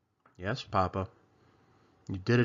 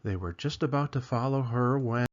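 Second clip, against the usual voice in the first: plain reading voice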